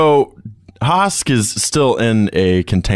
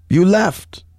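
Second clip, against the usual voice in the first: wounded anger; 10 semitones higher, 3 dB louder than usual. Acted. Father rebukes son in cell.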